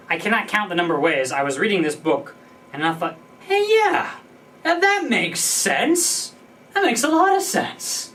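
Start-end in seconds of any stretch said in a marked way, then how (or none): 3.4-8.1 much higher, eager agreement; light up with a sudden aha; delighted mock reaction, slowing on "makes sense"; excited realization. Acted reaction while reading.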